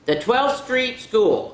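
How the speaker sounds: lively storybook read-aloud; reading to children. Acted. Teacher reading a picture book to children, lively. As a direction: read it out to the kids with bounce.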